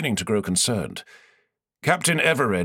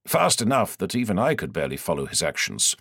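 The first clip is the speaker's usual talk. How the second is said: ordinary delivery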